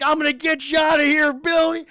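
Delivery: high, loud battlefield yell; desperate shout. Acted. Mister Cunningham rescuing Billy in imagined war zone, high desperate shout. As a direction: shout as if over explosions.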